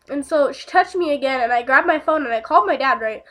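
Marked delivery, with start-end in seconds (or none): none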